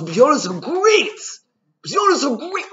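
imitating an ecstatic rabbi; joyful rebbe. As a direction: belt it out like a rabbi dancing with joy. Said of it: "he was dancing and singing".

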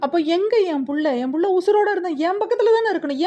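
high, fast, frantic demand; frantic outcry. A narrator's reading. Mother demands to see her newborn, high fast frantic outcry. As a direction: plead wildly, rushing the words. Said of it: "ஆர்ப்பாட்டம் செய்தார்".

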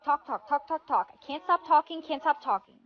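fast, quiet nonstop chatter; super-fast talking. Acted. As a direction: rattle off the words without stopping. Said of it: "I have to talk super fast".

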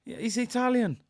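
high-pitched, astonished confirmation; stunned, pitched well up; amazed realisation. Real, not acted. Realising he was wrong.

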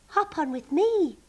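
high, calling invitation; friendly shout. A narrator's reading. Sheep calls the rabbit onto the swings, high-pitched shout. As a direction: call a friend over to join in. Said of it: "shouted Barbara".

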